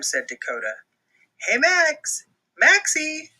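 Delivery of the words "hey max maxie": high, loud calling out; Brody calling for his friend. Acted. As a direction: call for Max across the house. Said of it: "called Brody".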